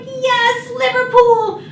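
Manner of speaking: high, loud eager cheer. Retold or imitated. Young girl joining classmates' cheer, high eager. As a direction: join in the cheering keenly.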